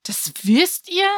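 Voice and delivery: genuinely taken aback; a boy amazed that everyone knows his hiding place; surprised. A narrator's reading. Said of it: "fragte Benny überrascht".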